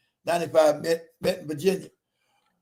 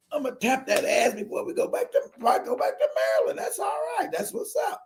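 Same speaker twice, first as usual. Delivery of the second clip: hushed, scheming self-talk; inner thought; 5 semitones higher, 7 dB quieter than usual. Retold or imitated. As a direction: whisper it to yourself, privately pleased. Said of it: "that's what I'm thinking in my mind".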